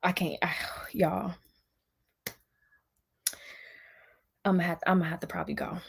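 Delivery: hushed, breathless whisper, almost losing it. Real, not acted. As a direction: drop to a whisper to hold back. Overwhelmed, stops herself mid-rant.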